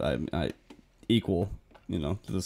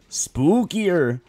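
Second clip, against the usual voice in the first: spookier rising; 6 semitones higher, 5 dB louder than usual. Retold or imitated. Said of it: "the difficulties would go spooky".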